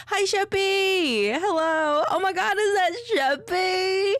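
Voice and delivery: very high, loud gushing greeting; excited squeal. Real, not acted. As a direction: squeal with delight at spotting them, drawn out. Gushing squeal on spotting Sheppy.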